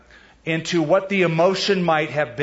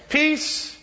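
drawn-out greeting; 12 semitones higher than usual. Retold or imitated. Jesus greeting frightened disciples.

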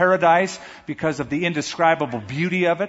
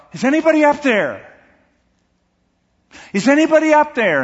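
high, loud cry upward from a cliff; desperate calling out; 11 semitones higher, 6 dB louder than usual. Acted. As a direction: shout for help into the canyon. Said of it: "he called out".

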